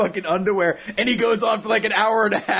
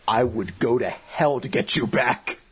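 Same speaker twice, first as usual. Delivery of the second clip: low, slow mock-heroic vow; solemn devotion; 9 semitones lower than usual. Toriko vowing to rescue Komatsu, solemn devotion. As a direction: swear it with hammed-up gravity.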